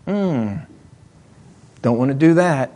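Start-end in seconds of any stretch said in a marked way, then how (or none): none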